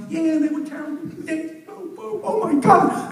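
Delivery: high, slowing mock dismay; appalled. Zoo guy hearing the chimp's story, high appalled. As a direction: gasp out oh my God up high.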